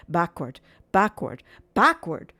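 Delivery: rising three-step emphasis; building emphasis. A narrator's reading. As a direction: build it up word by word. Building repetition.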